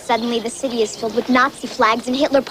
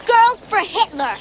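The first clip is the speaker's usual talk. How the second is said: high, drawn-out jeer; mocking, raised-pitch slogan; scornful taunt; 10 semitones higher, 4 dB louder than usual. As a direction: throw it out as an accusation. Friend taunts over Nazi uniform.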